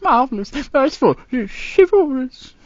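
normal talking voice

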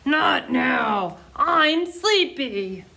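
slow, grumbling lion voice, half asleep; a storybook lion grumbling at being woken; sleepy lion growl. Acted. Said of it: "Lion growled".

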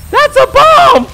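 high panicked shout of warning; alarmed shout. Real, not acted. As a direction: yell it out in alarm. Warning partner of bomb.

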